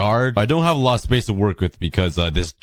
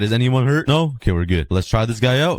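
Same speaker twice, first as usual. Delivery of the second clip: usual speaking voice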